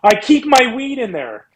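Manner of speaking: high, slow mock reveal; deadpan confession. Comedian revealing what's in the inhaler, slow high put-on voice. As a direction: drop the punchline slowly in a put-on voice. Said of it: "And he just goes".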